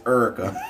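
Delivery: "Erica" with the first vowel turned into an r-sound, like "Urica"; "Erica" in a laughing Saint Louis voice; Saint Louis pronunciation. Shown as a demonstration. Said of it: "Saint Louis is Erica".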